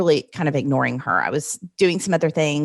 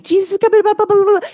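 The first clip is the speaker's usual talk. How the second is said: frantic rapid-fire prayer; 6 dB louder, much faster than usual. Retold or imitated. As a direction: imitate a panicked, high, loud gabbled prayer. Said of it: "as fast as I can".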